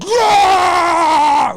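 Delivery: high, long mock roar; imitated triumphant roar. Host playing the donor landing a dunk, imitated roar. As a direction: roar like a player after dunking. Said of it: "he's like".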